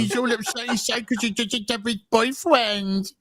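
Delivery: put-on high, drawn-out pouty baby whine; mock him as a lovesick, pining crybaby. Mocks a character pining over boyfriend.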